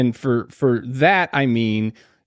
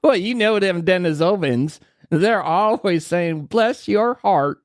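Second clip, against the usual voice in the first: slow Southern hillbilly drawl; hillbilly accent, higher and slower; 6 semitones higher, slower than usual. Pretend. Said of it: "hillbilly accent".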